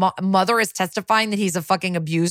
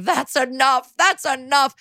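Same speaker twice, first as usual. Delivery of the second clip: retold yelling; 7 semitones higher than usual. Grandma shouts from the courtroom gallery, retold as a high mock yell. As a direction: imitate a worked-up grandma protesting. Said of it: "She's yelling".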